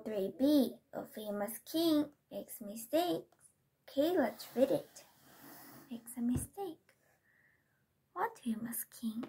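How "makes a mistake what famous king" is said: soft unvoiced whisper. Real, not acted. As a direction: whisper the words to yourself. Whispering title to herself.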